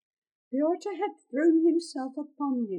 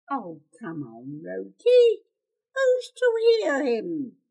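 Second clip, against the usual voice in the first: scoffing robber; 7 dB louder than usual. Acted. Second robber stays the killing.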